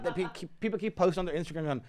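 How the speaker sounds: ordinary delivery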